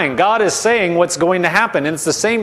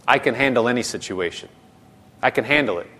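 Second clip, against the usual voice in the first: low, soft, settled affirmation; composed and hushed, modelling calm confidence; calm self-assurance; 7 semitones lower, 5 dB quieter, faster than usual. Shown as a demonstration. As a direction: reassure yourself quietly, in a lower voice. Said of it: "I'm calm".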